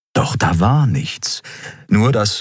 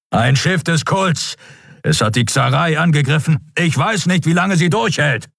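urgent dragon warning; 9 semitones higher than usual. Acted. Kero warns of the cult ship.

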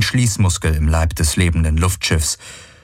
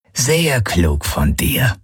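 grumbling creature; 5 semitones higher, much slower than usual. A narrator's reading. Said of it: "brummte Knochenfresse".

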